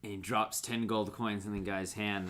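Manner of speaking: ordinary talk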